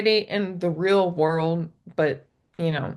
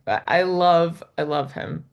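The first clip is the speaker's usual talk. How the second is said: ordinary talk, nothing marked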